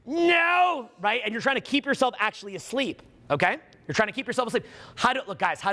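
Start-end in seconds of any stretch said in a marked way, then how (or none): 0.1-0.9 shouted no. Acted. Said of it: "Ego, fast food".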